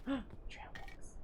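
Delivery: gasping, delighted whisper; excited whisper. Real, not acted. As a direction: whisper it like a treasure. Finds trail mix, gasps.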